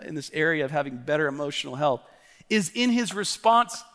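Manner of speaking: ordinary delivery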